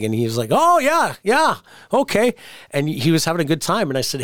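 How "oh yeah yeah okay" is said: tipsy Curt. Retold or imitated. Curt answers drunk on phone.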